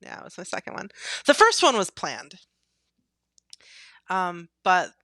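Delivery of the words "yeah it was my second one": whispered aside. Real, not acted. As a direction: say it under your breath. Aside confirming, voice drops away.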